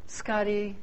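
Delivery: high, soft, swooning call of the name; Jennifer swooning. Retold or imitated. Pastor imitates Jennifer doting on Scotty, softly swooning. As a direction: sigh the name like a smitten fan. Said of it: "she's like".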